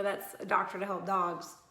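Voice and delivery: usual speaking voice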